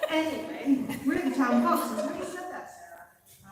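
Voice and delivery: ordinary talk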